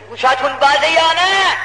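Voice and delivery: high, loud call to the congregation; ringing preacher's challenge, pitched well up. Real, not acted. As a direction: raise your voice sharply to demand their attention. Preacher challenges his listeners mid-story.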